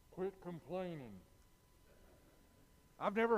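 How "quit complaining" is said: ordinary delivery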